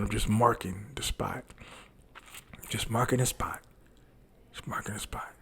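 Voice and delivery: soft, hushed imitation of the doctor; whispered doctor. Doctor whispering to him while marking the spot. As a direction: murmur it like a careful doctor.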